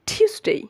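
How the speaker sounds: "টিউসডে" as high "TYOOS-dey", falling; correct pronunciation. Shown as a demonstration. Teacher modelling for learners, emphatic correct pronunciation. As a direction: punch an aspirated "tyoos" high, then glide "dey" in "টিউসডে". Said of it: "Repeat after me".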